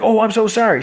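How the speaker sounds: high, put-on sorry voice, hammed up; mock apology. [name] apologizing to Doll after grazing her, high mock sorry. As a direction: play it as a squeaky, overdone oops.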